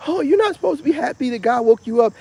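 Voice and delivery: high, mocking voice of the doubters; mocking critics. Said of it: "I know some people say".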